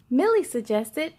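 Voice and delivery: plain reading voice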